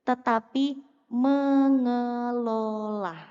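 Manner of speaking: "mengelola" drawn out very slowly and falling; stress on mengelola. Shown as a demonstration. Teacher stressing the key word slowly to the audience. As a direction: stretch every syllable of "mengelola".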